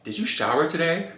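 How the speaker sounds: high, rising blurted question. Retold or imitated. Woman to date after smelling him, blurting. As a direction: let the question slip out high before thinking. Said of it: "I just blurt out".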